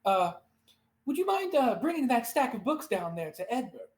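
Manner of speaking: high elf scribe suddenly remembering a favour; Columbo-style afterthought. Acted. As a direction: pitch up on an afterthought, then ask politely. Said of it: "she does, like, a Columbo".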